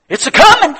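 very high, loud cry; triumphant shout. Real, not acted. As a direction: shout the promise to the congregation. Climax of the sermon.

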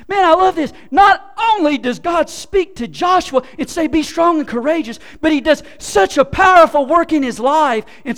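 loud, high, fervent preaching; full-force preaching. Real, not acted. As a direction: proclaim it to the whole room.

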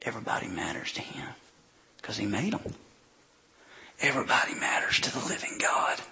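soft, hushed whisper for emphasis. Real, not acted. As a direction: lean in and whisper it to the congregation. Preacher's hushed emphasis on God's love.

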